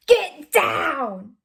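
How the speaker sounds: loud, high-pitched yell; shouted command. Acted. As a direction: yell it like a sudden order. Sudden shouted line in read story.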